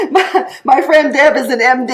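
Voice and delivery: talking through real laughter; laughing. Real, not acted. Host reading a friend's comment aloud, laughing as she speaks. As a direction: chuckle while you explain.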